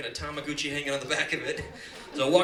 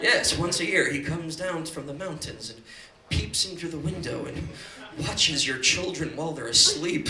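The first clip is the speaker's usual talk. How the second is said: normal talking voice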